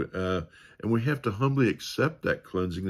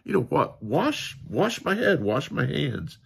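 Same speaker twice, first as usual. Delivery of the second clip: much higher, eager burst; excited Peter; 7 semitones higher than usual. Retold or imitated. Peter begging Jesus to wash his head too, excited. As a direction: gush with over-the-top zeal. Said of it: "so excited".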